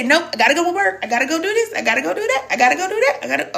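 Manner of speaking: mock busy excuse-maker. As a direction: play someone too busy to listen, lightly mocking. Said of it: "and you say".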